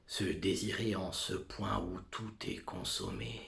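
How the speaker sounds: normal talking voice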